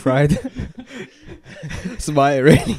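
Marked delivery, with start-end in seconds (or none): 0.4-1.9 breathy high giggle; quiet high laugh. Real, not acted. Laughs at deep fried cows.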